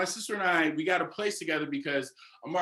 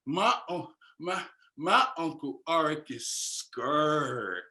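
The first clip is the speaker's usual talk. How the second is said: put-on teasing child voice; much slower than usual. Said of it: "he was like".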